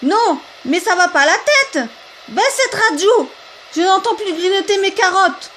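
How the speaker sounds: high, loud indignant complaint; angry complaint. Acted. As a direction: scold the noisy neighbour. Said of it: "de se plaindre". (plainte indignée, aiguë et forte)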